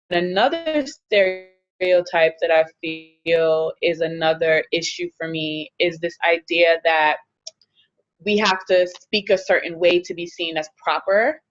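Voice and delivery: usual speaking voice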